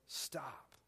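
stop, dropped low and soft; hushed command. Shown as a demonstration. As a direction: let the word land quietly. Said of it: "I'm gonna say that again".

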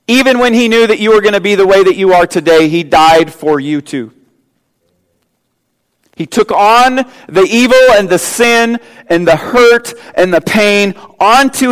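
high, loud pulpit proclamation; preaching at full force. Acted. Preacher to congregation about good news, loud preaching. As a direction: lift the room with the good news. Preacher builds on Christ's sacrifice.

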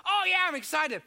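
put-on high, loud eager boy; excited kid. Boy telling the pastor about the game, high and loud. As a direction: gush like a thrilled little fan. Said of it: "And he goes".

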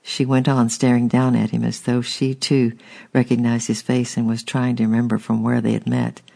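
usual speaking voice

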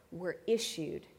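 normal talking voice

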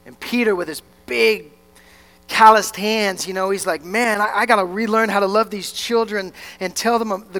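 fervent sermon storytelling at high energy; impassioned preaching. Real, not acted. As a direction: preach it with full conviction, punching key words.

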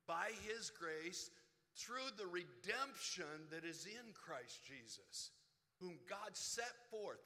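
slow, loud pulpit proclamation; scripture reading. Acted. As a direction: proclaim the verse slowly from the pulpit. Reads Romans aloud from pulpit.

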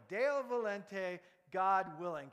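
Deo volente, said loud and slow as DAY-oh vo-LEN-teh; Latin phrase. Shown as a demonstration. Preacher teaching the congregation to say Deo volente. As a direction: pronounce Deo volente clearly for the crowd. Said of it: "Can we say it together".

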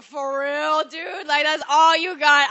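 loud, high incredulous outburst; exasperated outburst. Retold or imitated. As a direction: throw it at him in disbelief. Retold outrage at his okay.

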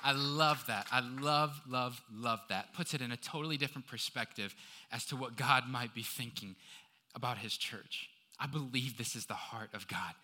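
low, soft, earnest pulpit voice; intimate, reverent aside to the congregation; hushed preaching. Real, not acted. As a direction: let it sink in quietly and sincerely.

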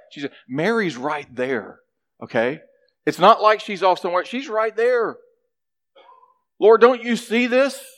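tattle on your sister with rising outrage; critical complaint. Acted. Martha accuses Mary of slacking to Jesus, building indignation. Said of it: "She's critical and judgmental".

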